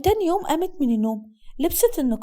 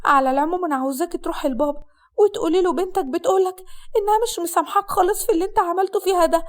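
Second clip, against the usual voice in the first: higher, upset voice of a reproachful bride; aggrieved bride; 5 semitones higher than usual. Acted. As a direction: reproach with hurt, pitched up. (عروسة مقهورة بتعاتب)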